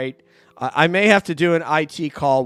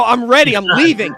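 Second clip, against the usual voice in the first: a light, higher, louder mock voice of someone rushing off; mock eager quote; 8 semitones higher, 10 dB louder than usual. Host voicing Maria leaving, light mock chirp. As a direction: toss it off like a guest bolting from the call.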